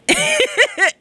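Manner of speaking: squealing high laughter. Real, not acted. DJ squealing with laughter over a wild band. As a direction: laugh in delight.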